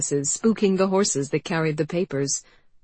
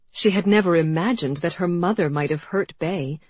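plain reading voice

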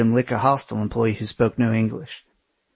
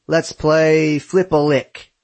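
put-on thick Aussie accent, slow and higher; Australian accent; 5 semitones higher, 4 dB louder, much slower than usual. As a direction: drawl it like a big Aussie bloke. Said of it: "he said in his thick Australian accent".

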